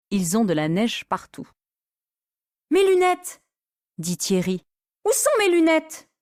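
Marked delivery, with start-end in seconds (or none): 2.7-6.1 high alarmed cry; panicked. Acted. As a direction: panic for the lost glasses. Thierry panics for glasses. (Thierry cherche ses lunettes, paniqué et aigu)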